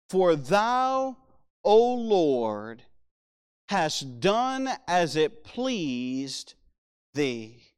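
very slow, weighty verse with long pauses; scripture reading. Acted. Preacher rereads the line he loves, slow reverent reading.

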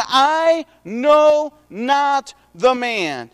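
every word of "I know not the man" stretched out slowly; emphatic denial. A narrator's reading. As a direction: deny it deliberately, leaning long on "know" and "not". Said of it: "emphatically three different times".

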